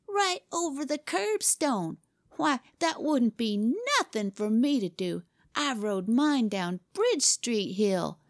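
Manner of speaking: put-on high, scornful boyish bragging; derisive. A narrator's reading. Boy belittles his rival's curbstone feat, derisive. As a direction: scoff at his feat and top it with your own. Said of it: "Jimmy's derision was a splendid sight".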